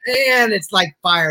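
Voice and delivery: hyped up. Real, not acted. Host hyping the show intro, slow and loud. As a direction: stretch it out with real excitement.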